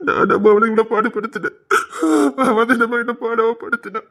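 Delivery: sobbing lament to mother; tearful, gasping between phrases; crying. Acted. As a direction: pour out the humiliation through sobs. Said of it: "நீ ஏன்டா அழுதுட்டு இருக்கா".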